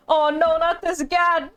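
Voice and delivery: ordinary talk, nothing marked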